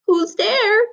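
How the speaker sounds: put-on high, loud old lady call; granny calling. A narrator's reading. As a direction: call out through the door as Granny. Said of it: "called out a voice".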